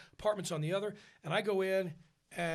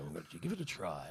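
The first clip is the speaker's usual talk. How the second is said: very low, soft, quick captain's mumble; casual airline captain; 12 semitones lower, 7 dB quieter, faster than usual. Retold or imitated. Captain tells passengers about the next attempt, low and offhand. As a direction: toss it off like a pilot on the intercom.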